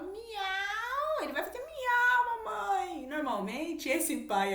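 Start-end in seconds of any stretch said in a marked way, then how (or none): 0.0-1.2 high mock meow; a playful high miau; cat meow. Parent meowing sweetly with a child, high mock meow. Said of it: "Tem filho que você vai miar". 1.2-3.1 high, laughing mock meow; playful child miau. Parent describing child meowing back, high laughing voice. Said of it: "Tem filho que você vai miar".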